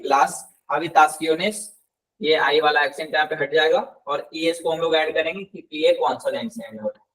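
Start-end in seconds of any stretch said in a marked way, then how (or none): 0.0-1.6 लास habitaciones said slowly, rising on habitaciones; slow, clear plural. Shown as a demonstration. Teacher modelling the plural for students, slow and clear. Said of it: "ला को हम लोग लास करेंगे".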